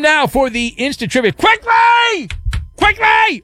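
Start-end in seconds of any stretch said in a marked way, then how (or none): 1.3-2.3 very high, stretched yell. Pretend. Announcing the Instant Trivia, yelled high. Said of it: "why are you yelling at me". 2.8-3.4 very high-pitched comic yell. Pretend. Hurrying the trivia along, yelled high. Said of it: "why are you yelling at me".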